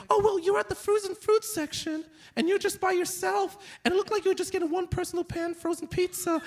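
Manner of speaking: high-pitched tattling kid imitation; second-grader voice. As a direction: rattle it off like a nosy little pupil. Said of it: "He's like".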